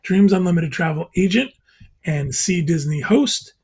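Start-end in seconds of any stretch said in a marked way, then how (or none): none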